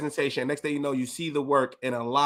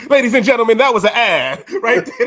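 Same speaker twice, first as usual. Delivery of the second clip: louder, laughing showman intro, slowed; laughing announcement; 8 semitones higher, 11 dB louder than usual. Real, not acted. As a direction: present it like an ad host. Host announces like a presenter.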